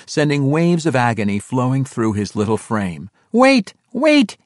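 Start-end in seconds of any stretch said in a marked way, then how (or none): 3.3-4.4 high, loud plea; begging cry. A narrator's reading. Hurt halfling on the bank begs his captors, crying out. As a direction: cry out desperately, pitched way up. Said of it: "he begged".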